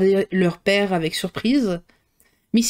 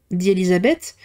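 plain reading voice

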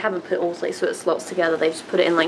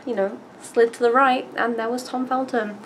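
normal talking voice

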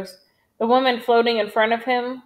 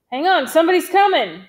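high, raised alert call; urgent warning; 7 semitones higher, 5 dB louder than usual. A narrator's reading. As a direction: alert the squad loudly. Squad leader warns his men.

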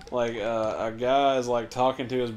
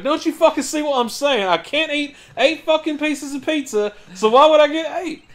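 high, loud exasperated rant; mock exasperation; 14 semitones higher, 8 dB louder than usual. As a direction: imitate a fed-up girlfriend, shouting high. Acted exasperated girlfriend in argument.

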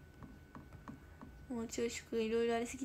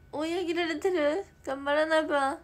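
higher, louder startled push; alarmed and excited; 6 semitones higher, 10 dB louder than usual. Real, not acted. Streamer hearing she's being caught up, fired up. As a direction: gee yourself up loudly. Reacting to game news.

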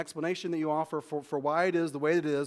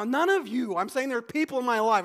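usual speaking voice

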